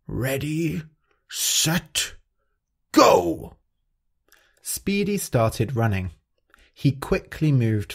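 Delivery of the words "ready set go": calling out the start. Acted. Crush starting the race for the animals, high and building. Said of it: "Crush called out the start".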